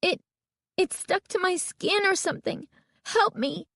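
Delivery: high, stammering distress; frightened high-pitched pleading; panicked plea. A narrator's reading. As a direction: beg for help, hurting and scared. Girl trapped in mask begs help.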